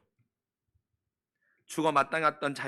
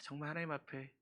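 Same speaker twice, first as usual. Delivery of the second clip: usual speaking voice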